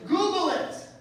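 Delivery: shouted command; loud insistence. Real, not acted. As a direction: punch it at the audience.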